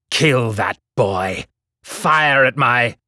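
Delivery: slow, furious villain shout, cut off; screaming order. A narrator's reading. Kodak ordering his soldiers from the roof, furious scream. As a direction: bellow the kill order until struck. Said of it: "Kodak screamed".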